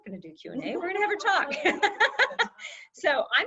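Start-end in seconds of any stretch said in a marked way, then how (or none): 0.8-2.5 high, drawn-out words through a real laugh. Real, not acted. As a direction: laugh it out at your own slip. Host laughs at her own slip.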